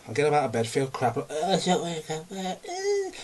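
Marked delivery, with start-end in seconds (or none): 1.3-3.1 mock whine. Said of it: "crying about it almost".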